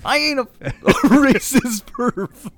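high, loud, slow words through real laughter; laughing protest. Real, not acted. Host denying the perv tease, laughing loudly. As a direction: protest while helpless with laughter.

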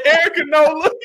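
high, wailing fake plea; mock crying. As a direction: cry out the name in a hammed-up high voice. Mock crying out a woman's name.

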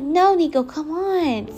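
firm mock call to Nico, come along; retelling how she called her playful dog away. Calling dog away, retold.

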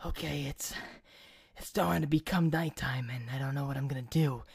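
low, soft strangled voice with a hand pressed to the throat; low choked dying voice. Shown as a demonstration. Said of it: "I was talking like this".

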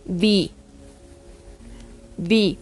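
"be" said BI with a Spanish I. Shown as a demonstration. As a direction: model "be" with its E as an I. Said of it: "se va a pronunciar". ("be" pronunciado con I: bi)

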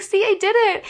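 high, sweetly pleased imitation; really sweet. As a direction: chirp it sweetly as the proud learner. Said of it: "Like, really sweet".

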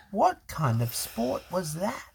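ordinary delivery

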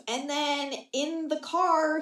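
ordinary talk

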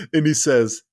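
usual speaking voice

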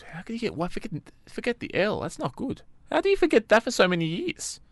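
incredulous at the misspelling; disbelief. Real, not acted. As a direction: sound amazed that anyone could forget the L. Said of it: "That's ridiculous".

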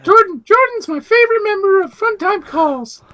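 squeaky, very high cartoon gator; loud falsetto mascot squeak; Gus the Gummy voice. Gus praising a friend, squeaky cartoon voice. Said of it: "thanks, Gus".

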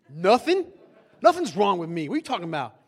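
loud mock-indignant comeback; huffy denial. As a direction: throw it back loudly, acting offended. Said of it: "she can tell 'cause I'm grumpy".

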